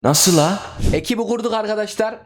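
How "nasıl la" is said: loud disbelief. Real, not acted. Disbelief at failure.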